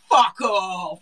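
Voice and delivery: shouted British outburst, played for laughs; hammed-up British talk-show shout; British trash-TV accent. Shown as a demonstration. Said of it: "the entire morning show in this accent".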